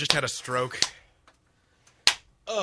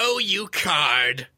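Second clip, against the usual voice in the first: mock coy flirt; much slower than usual. Mock coy reply, slow.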